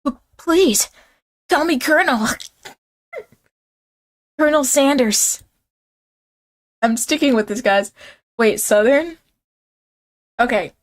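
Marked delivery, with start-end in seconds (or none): 0.1-5.4 higher, hammed-up anime voice; mock anime introduction, pitched up. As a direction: do a silly high anime character, breaking into a laugh. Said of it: "an anime voice or a Southern accent".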